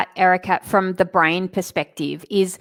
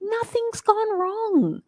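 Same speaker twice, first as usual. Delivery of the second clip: high reassuring no; 13 semitones higher, 4 dB quieter than usual. Acted. Correcting a worried client.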